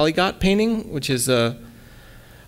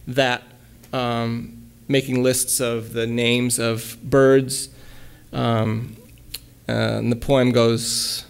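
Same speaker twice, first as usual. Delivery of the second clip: normal talking voice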